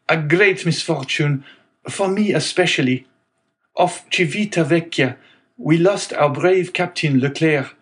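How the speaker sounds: a hint of sorrow in the young sailor; young sailor Dantès. A narrator's reading. Dantès tells the owner his captain died, gently grieved.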